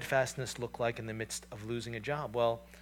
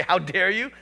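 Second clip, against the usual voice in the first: mock road rage; 5 semitones higher, 8 dB louder than usual. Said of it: "shake your fist and say".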